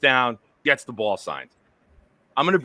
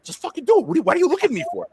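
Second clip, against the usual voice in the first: much higher, rushed and exasperated; exasperated yell; 12 semitones higher, much faster than usual. Retold or imitated. Host snapping at a hesitant kid, exasperated yell. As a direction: snap at the kid to hurry up. Retold pushy command to kid.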